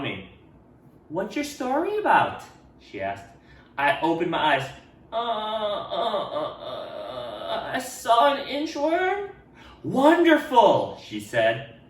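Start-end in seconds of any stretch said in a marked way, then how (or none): 1.1-2.4 higher, friendly teacher asking; a bright teacher questioning a pupil. Acted. Teacher asking Ralph about his story, higher voice. 5.1-9.3 put-on hesitant boy stammering, slower; nervous stammer. Ralph stammering to his teacher, slow and nervous. 9.8-11.0 drawn-out, higher and louder; delighted praise. Acted. Teacher praising Ralph, slow and delighted.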